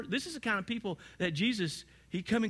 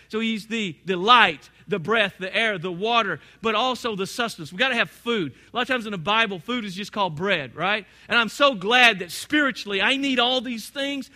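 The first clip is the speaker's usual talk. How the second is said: normal talking voice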